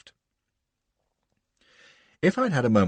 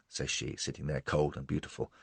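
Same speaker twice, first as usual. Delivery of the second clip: ordinary delivery, nothing marked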